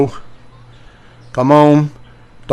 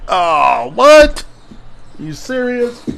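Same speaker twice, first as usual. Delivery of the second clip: surprised disbelief; 8 semitones higher, slower than usual. Real, not acted. Disbelief at a card pull.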